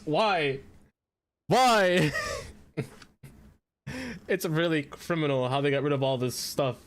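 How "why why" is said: loud, high, pained "why"; exasperated cry. Real, not acted. Rail fan crying out over lost trains, exasperated shout. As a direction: throw the word up in despair. Rants about abandoned rail lines.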